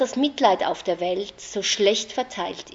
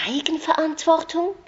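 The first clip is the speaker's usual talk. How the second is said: "Eigenverantwortung?" repeated much higher with a long incredulous rise; stunned echo; 10 semitones higher than usual. A narrator's reading. Anna echoing the narrator's word, stunned and incredulous. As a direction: throw the word back in disbelief. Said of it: "wiederholte Anna fassungslos".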